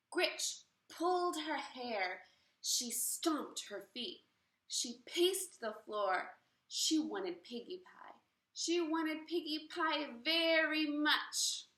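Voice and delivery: slow, emphatic storytelling; story narration with weight on verbs; dramatic read-aloud. A narrator's reading. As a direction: read each action slowly for the kids. Dramatic story reading to class.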